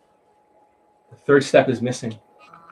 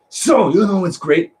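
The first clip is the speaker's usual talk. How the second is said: slow, higher-pitched angry drawl; mock angry; 6 semitones higher, slower than usual. Coach mimicking students turning angry again, mock angry. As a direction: imitate a dragged-out cross opener. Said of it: "They get angry again".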